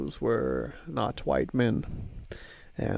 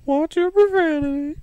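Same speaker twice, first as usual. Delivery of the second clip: very high, steady robotic scold; mock scolding; 4 dB louder than usual.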